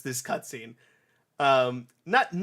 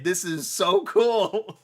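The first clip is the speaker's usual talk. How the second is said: ordinary talk, nothing marked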